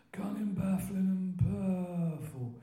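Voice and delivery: put-on high, slow Croydon old-timer; Len B impression. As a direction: do the party-piece impersonation, every word drawn out. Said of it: "Good, do a Len B".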